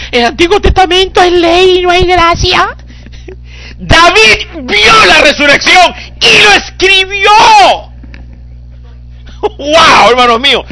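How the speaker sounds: fervent preaching. Acted. Preacher proclaiming David saw resurrection, crying loud preach. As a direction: let the voice break as you cry out.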